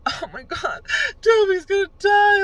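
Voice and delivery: high, loud, wailing sob of grief; hammed-up tearful wail; mock crying wail. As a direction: wail like a fan watching Dobby die. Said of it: "I will fucking cry the whole fucking time".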